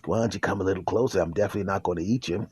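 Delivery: low, soft and fast, coaxing; a low, fast, sweet-talking dog; sly dog voice. Acted. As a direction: lure him in gently, low and quick. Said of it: "And the dog says".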